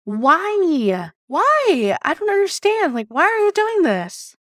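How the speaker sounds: high, pleading exasperation; exasperated protest. Retold or imitated. Employee demanding reasons from boss, exasperated. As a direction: re-enact a baffled protest, pitch climbing high. Acted argument with her boss.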